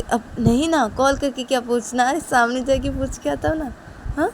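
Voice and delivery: lightly voiced stubborn boy; wheedling brother. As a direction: argue to go in person. Rahul arguing back.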